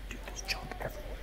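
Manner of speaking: whispering. Real, not acted. Sneaking through abandoned house.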